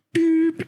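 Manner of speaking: high-pitched spoken beep; imitated hang-up beep. Ending a call with an upset parent, high mock phone beep. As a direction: make a short high phone boop.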